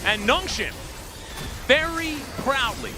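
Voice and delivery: high, loud, fast caster call of the game-ending fight; rising esports play-by-play; hype play-by-play. Acted. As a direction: ride the fight to the Nexus with full hype. Live play-by-play of game end.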